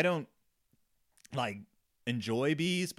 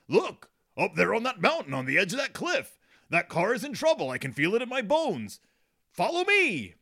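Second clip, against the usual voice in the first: higher, louder, hammed-up heroic call; rally the troops, certain of disaster; heroic Papa Bear; 6 semitones higher, 6 dB louder than usual. Papa Bear calls the cubs to save the car, hammed-up heroic shout.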